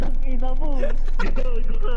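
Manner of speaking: high, loud cursing yell; frightened yelling. Real, not acted. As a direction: yell in shock, stretched out. Jump scare, yelling at friend.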